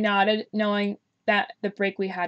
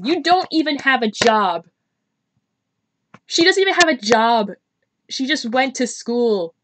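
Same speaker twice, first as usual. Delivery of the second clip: louder, higher mock outrage; mock indignant shout; 4 semitones higher, 7 dB louder than usual. Real, not acted. The player scolds Sarah, in a loud, high mock-indignant shout. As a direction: scold the character, pitched up loud.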